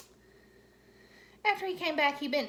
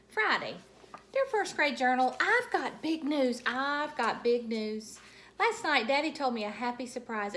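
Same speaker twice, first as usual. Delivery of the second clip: bubbly first grader bursting with news; Junie B voice; 3 semitones higher, 5 dB louder than usual. Acted. Junie B journal entry read aloud.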